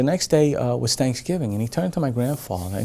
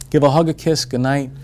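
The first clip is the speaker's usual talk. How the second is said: usual speaking voice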